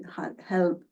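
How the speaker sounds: ordinary talk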